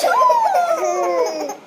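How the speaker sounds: loud, high, hammed-up sneeze; an explosive storybook sneeze; big sneeze.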